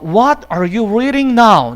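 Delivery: every word of "He is the very man" spaced out very slowly; slow model reading. Shown as a demonstration. English teacher reading the example sentence very slowly. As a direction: read it out word by word for the class. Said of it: "example sentence".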